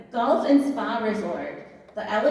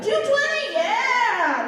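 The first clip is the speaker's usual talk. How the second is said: much higher, cheering a new bid; excited squeal; 10 semitones higher, much slower than usual. Real, not acted.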